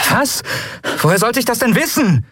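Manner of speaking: defensive outburst; startled protest. A narrator's reading. Pilot defends herself under fire.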